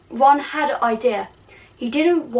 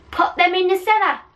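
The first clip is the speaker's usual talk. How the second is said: high, loud villainess order; shrill-free mock yell from a villain; Bellatrix yelling; 8 semitones higher, 4 dB louder than usual. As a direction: bark the order like a mad witch. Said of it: "amazing Bellatrix impression".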